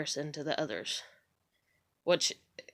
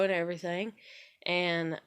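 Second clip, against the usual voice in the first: ordinary talk, nothing marked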